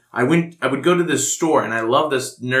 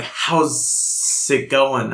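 very slow snake voice, words drawn out; snake person voice; much slower than usual. Shown as a demonstration. Player recalling their snake-person character, drawn-out obnoxious snake voice. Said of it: "I, I talked like".

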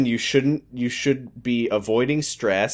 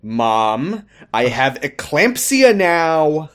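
slow, louder, higher mock whine; mock whiny daughter; 3 semitones higher, 4 dB louder, slower than usual. Daughter telling mom about her date, mock whiny complaint. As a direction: drag out the complaint like a sulky teen. Voicing Amy's joke complaints.

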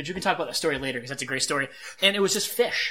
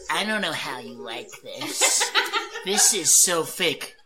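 mocking wife; much slower than usual. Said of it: "she just goes".

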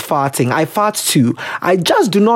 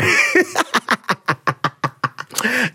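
long real laughing fit; 4 semitones higher, 4 dB quieter than usual. Real, not acted. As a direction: cackle that keeps going. Laughs at own advice.

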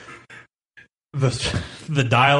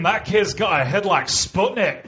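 Scottish dad quote; 5 semitones higher than usual. Retold or imitated. Quoting Scottish dad movie line.

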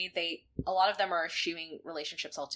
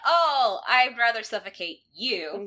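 smitten villain; 4 semitones higher, 9 dB louder, slower than usual. Retold or imitated. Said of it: "is like".

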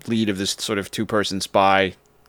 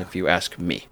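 ordinary talk, nothing marked

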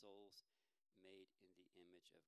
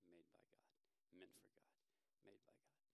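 quiet self-reminder; 10 dB quieter than usual. Retold or imitated. Repeating a mantra to himself.

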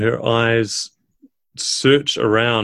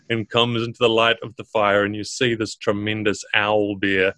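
normal talking voice

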